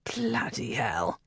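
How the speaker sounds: slow, low, whispered Bloody hell; muttered curse. Acted. Devon realising it is only a game, whispering the curse. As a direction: breathe the oath under your breath. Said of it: "Devon muttered".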